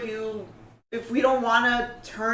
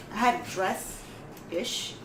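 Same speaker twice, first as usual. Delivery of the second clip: ordinary talk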